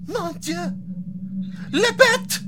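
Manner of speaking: put-on high, shrill French girl in fright; frightened French girl. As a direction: gasp it out like a terrified child. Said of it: "the girl says".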